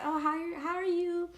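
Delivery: high, sugary polite hello; sweet greeting. Retold or imitated. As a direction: sound sweet and friendly. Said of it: "she seemed so sweet".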